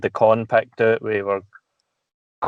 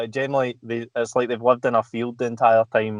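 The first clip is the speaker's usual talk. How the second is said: normal talking voice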